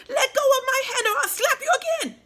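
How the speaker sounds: a trapped fairy screaming to be let go; furious fairy. A narrator's reading. Stuck fairy threatens the doll.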